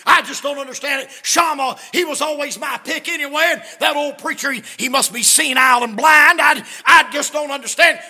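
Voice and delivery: loud, high hammed-up complaint; offended wife ranting; indignant mother. Wife's indignant outburst continues.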